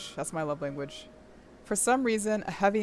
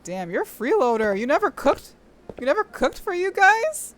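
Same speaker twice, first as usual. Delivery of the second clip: incredulous; 8 semitones higher, 6 dB louder than usual. Real, not acted. Incredulous at freeloader.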